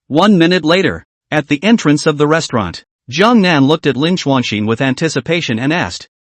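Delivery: usual speaking voice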